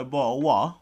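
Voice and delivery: failed attempt at Andrew Tate's accent; quick, clumsy go at a celebrity accent. Podcaster asking for a drink, bad Andrew Tate accent. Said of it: "I have no Andrew Tate accent".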